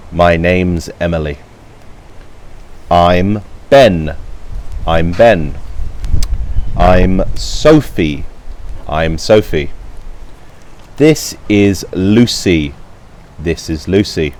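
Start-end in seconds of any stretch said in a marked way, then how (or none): none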